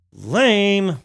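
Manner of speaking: exaggerated whine. Real, not acted. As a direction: overdo the disappointment. Repeats lame, louder higher.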